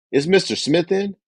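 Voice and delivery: high, timid question; weak salesperson. Shown as a demonstration. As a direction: ask meekly, eager to please. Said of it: "Here's a weak salesperson".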